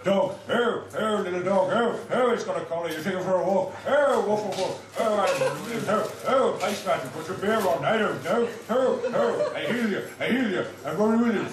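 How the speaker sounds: manic, loud improvising comedian; frantic riff on an audience word; Robin Williams. Acted. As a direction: punctuate everything with loud high yelps. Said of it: "my impression of Robin Williams".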